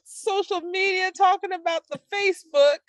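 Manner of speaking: put-on high, laughing cranky old-timer; old prospector. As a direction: gripe like a crotchety old geezer. Grumpy old women complaining bit.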